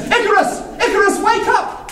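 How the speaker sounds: high, urgent call to wake; urgent waking call. Acted. Father waking his son to flee, urgent and high. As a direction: rouse the sleeping son in a hurry.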